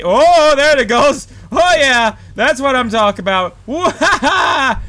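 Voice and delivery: loud, high triumphant whooping; excited cheer. Real, not acted. Gamer celebrating a successful launch. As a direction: cheer like the stunt landed.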